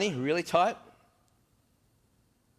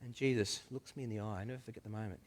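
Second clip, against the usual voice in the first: ordinary delivery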